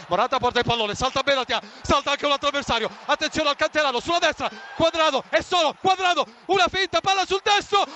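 climbing toward the goal call; building goal commentary. Real, not acted. (telecronaca in crescendo)

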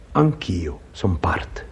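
slow, breathy whisper. Acted. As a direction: whisper it slowly to yourself. Goalkeeper's quiet inner words.

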